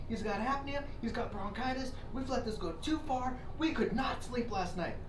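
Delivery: a hint of a high, whiny tattletale; whiny snitch. Recruit ratting out the snorer to the drill sergeant, lightly whiny.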